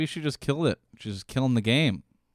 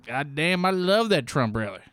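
lightly hammed rally fan; 4 semitones higher than usual. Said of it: "with, like, a trucker hat".

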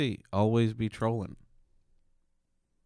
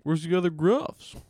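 higher-pitched mock puzzled goat; lone goat calling; 6 semitones higher than usual. Goat seeking the other Gruffs, higher playful voice. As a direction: play a goat looking for his pals.

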